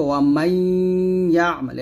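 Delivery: "মাইয়া" with a long nasalised y; slow down and hold the nasal inside "মাইয়া"; merged ghunnah. Shown as a demonstration. Teacher showing learners merging, "মাইয়া" with drawn-out nasal. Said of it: "এরকম তাসদিদ না আসলে তাসদিদ দিয়ে গুণনা করতে হবে".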